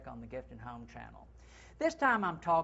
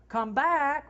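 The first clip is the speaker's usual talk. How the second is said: much higher, loud "Come back" called out; calling the dog; 10 semitones higher, 9 dB louder than usual. Pretend. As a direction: summon the dog back home. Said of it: "like you're calling to the dog".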